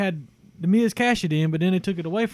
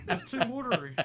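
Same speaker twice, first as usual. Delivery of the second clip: laughing; 4 semitones higher, 8 dB quieter than usual. Real, not acted. Laughing after eating pie.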